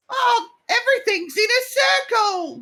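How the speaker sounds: very high, loud mock British voice; accidental British accent. Co-host spoofing a terrible American adaptation, in a high British accent. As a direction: squeal it in a hammy British accent. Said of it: "Why am I British?".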